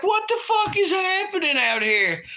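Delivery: put-on high-pitched, alarmed cry of a scared eight-year-old; panicked kid. Kid yelling at his parents during a nuclear war movie, panicked high voice. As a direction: shriek it like a terrified child. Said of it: "I'm, like, freaking out".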